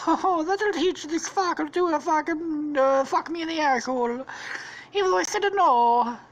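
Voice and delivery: high-pitched mock voice of a vengeful woman; gloating woman character. Squishy gloats while poisoning the cake, high put-on voice.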